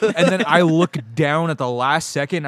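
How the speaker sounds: ordinary talk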